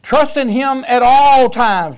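loud, slow, every word weighted; "Trust in him at all times" read slowly with "all" stressed; slow emphatic scripture. Real, not acted. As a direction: proclaim the verse slowly and loudly.

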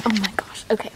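ordinary delivery

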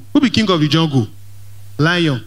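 ordinary talk, nothing marked

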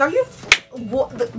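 high, sputtering indignation, cut off; hammed-up speechless outrage; outraged disbelief. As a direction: start to explode, then swallow the curse. Outraged thought at manager's double standard.

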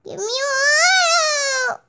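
put-on high, slow, wailing baby demand; a fussy toddler wailing for water; whiny baby voice. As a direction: cry it out like a bratty toddler. Voicing the crying baby's demand.

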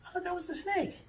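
high, puzzled protest; mock confusion. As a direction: play innocent, pitch way up. Feigns confusion after meowing as snake.

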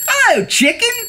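high-pitched greeting; Duck's cheery call. A narrator's reading. Duck greeting Dog from the bike, high cheery call.

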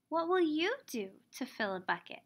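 ordinary talk

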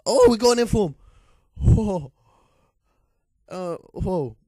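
real excited outburst at a lucky pull; excited shout. Real, not acted. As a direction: yell it out as the rare card appears. Pulls a rare inform card.

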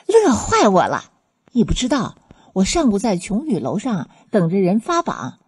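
elated, animated storytelling; overjoyed. Acted. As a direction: gush to a close friend about your triumph. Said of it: "看清他眉飞色舞的脸庞，".

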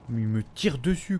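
ordinary talk, nothing marked

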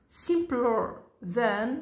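drawn out for the class to repeat; slow teacher demo; slow model reading. Shown as a demonstration.

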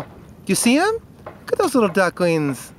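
very high question to the dog; pet talk. Real, not acted. Man asking Nikki if she sees the ducks, very high pet talk. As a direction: ask the dog in a squeaky high voice.

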